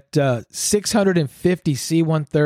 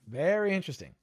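much softer, musing repeat; pointed aside; 11 dB quieter than usual. Real, not acted. Repeating a suspicion to listeners, pointed soft aside. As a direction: drop the volume and draw out "Very".

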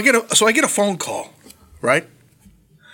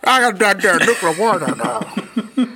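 slow, higher hammed-up yokel voice; put-on slow bumpkin, mangling the words; mock yokel; 4 semitones higher, slower than usual. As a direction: drag it out like a cartoon yokel. Mocking how people say nuclear.

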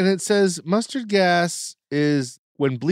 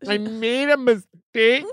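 slow, lightly voiced PR lady; a hint of a dopey PR lady; slow mock PR-lady whine; Nick Kroll's PR lady; 5 semitones higher than usual. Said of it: "Nick Kroll's PR lady character".

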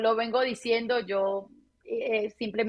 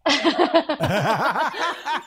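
loud bright burst of laughter; real laugh; 6 semitones higher, 5 dB louder than usual. Real, not acted. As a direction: laugh out loud, caught off guard. Guest laughs at the question.

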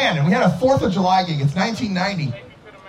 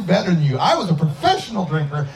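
ordinary talk, nothing marked

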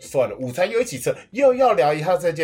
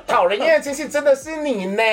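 higher, laughing through the jab; laughing tease; 12 semitones higher, 4 dB louder than usual. Retold or imitated. Nephew mocking annoying uncle, laughing. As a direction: laugh while landing the insult.

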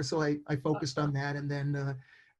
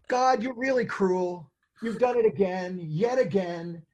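drawn-out, mock-aggrieved complaint to God; exasperated complaint; 3 semitones higher, slower than usual. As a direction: complain slowly to heaven, starting high.

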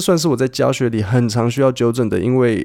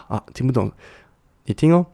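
normal talking voice

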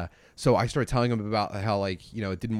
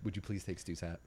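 very soft retold request; imitating a wife's hushed request; quiet plea; 3 semitones lower, 15 dB quieter than usual. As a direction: plead gently under your breath.

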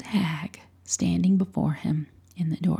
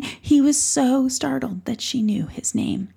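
ordinary delivery, nothing marked

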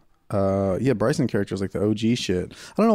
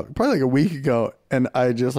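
normal talking voice

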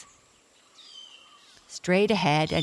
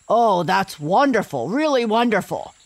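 a happy lion, higher and louder; delighted lion voice; 3 semitones higher, 6 dB louder than usual. A narrator's reading. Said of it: "said the lion".

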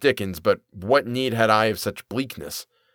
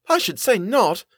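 high-pitched indignant retort; snapping; 12 semitones higher than usual. A narrator's reading. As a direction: snap it like an affronted mother. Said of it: "Mother snapped".

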